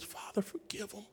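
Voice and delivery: very soft, barely voiced plea; hushed dying prayer. Preacher quotes Jesus dying on the cross, hushed plea. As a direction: act out a prayer on a last breath, softly.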